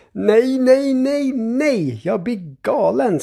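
high, drawn-out cry easing into complaint; screamed exasperation. A narrator's reading. Grandfather as milk goes into the batter, crying out. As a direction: wail at the end of your tether. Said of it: "skriker morfar".

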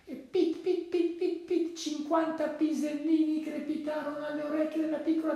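very high, tiny staccato voice; squeaky storybook narration; pattering sound effect. A narrator's reading. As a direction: patter it out high like little peas. Narrator imitates tiny pellets falling.

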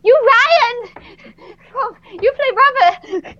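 high excited starstruck blurt. Retold or imitated. Fan meeting her favourite actor, high starstruck gush. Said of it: "I was like".

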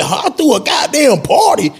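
high, incredulous repeat; disbelief. Retold or imitated. Stunned host learns he threw a party, high with disbelief.

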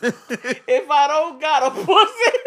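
high, laughing retelling. Acted. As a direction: crack up while saying it. Repeating quote cracking up.

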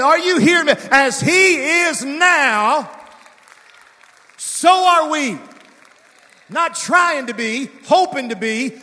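loud, high, rising preacher cadence; fiery revival sermon; full-force preaching. Acted. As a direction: push the church to its feet.

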